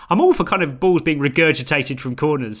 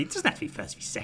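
mocking; 10 dB quieter, faster than usual. Mocking the pundit's phrase.

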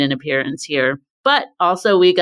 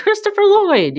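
sudden high squeal of delight at the name; light up like spotting a favourite actor; excited recognition. Retold or imitated. Recognising actor on screen, retold.

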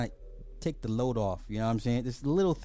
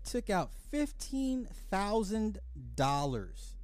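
slow mocking repeat; 3 semitones higher, slower than usual. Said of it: "slow mocking repeat of caller".

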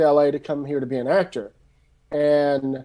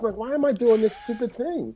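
mock frustrated inner voice; 8 semitones higher than usual. Frustrated at weird jobs.

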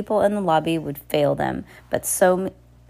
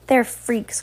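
raised-pitch cry; 6 semitones higher than usual. A narrator's reading. Hugo heckles from the crowd, high cry. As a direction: call out the accusation high. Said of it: "cried Hugo".

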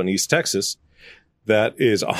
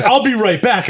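laughing quote; 12 semitones higher, 6 dB louder than usual. Retold or imitated. Landowner eager, quoted laughing.

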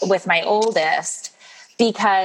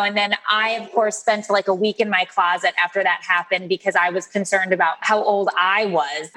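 normal talking voice

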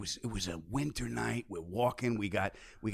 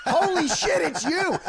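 high, loud starstruck yell; retold fan freak-out at full volume; excited screaming; 9 dB louder, slower than usual. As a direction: scream it like a fan meeting an idol. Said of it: "and I'm yelling, I'm screaming".

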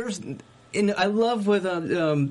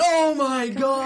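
stunned, higher and louder, slowly; shocked gasp; 7 semitones higher, 4 dB louder, slower than usual. Retold or imitated. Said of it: "we all went".

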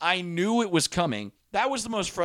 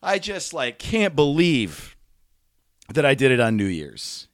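ordinary talk

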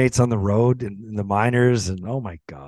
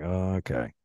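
subdued reaction; 4 semitones lower, 6 dB quieter than usual. Retold or imitated. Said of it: "Everyone's kinda like".

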